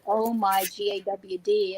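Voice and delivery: slow, drawn-out Long Island "Gawd"; Long Island accent. Retold or imitated. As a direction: stretch "God" like a Long Islander. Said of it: "She's like".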